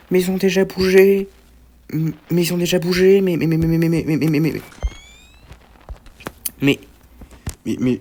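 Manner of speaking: high, stammering protest; flustered disbelief. Real, not acted. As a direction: splutter in disbelief, repeating the word. Flustered as enemies already moved.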